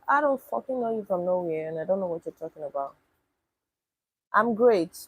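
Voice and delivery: ordinary talk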